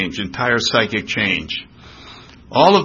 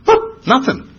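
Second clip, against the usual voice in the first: mock surprise; 13 semitones higher, 4 dB louder than usual. Looking back, finding nothing.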